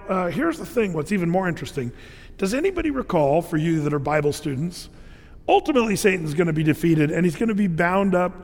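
ordinary talk, nothing marked

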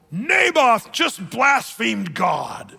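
loud, drawn-out public accusation; hammed-up town-crier denunciation; mock accusation. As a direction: proclaim it slowly to the whole council, scandalised. Jezebel accuses Naboth to the council.

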